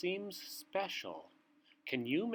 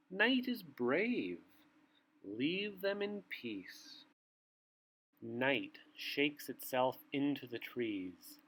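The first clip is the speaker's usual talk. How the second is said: bedtime story reading; slower than usual. A narrator's reading. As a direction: read it like a bedtime book to little ones. Reads bedtime picture book aloud.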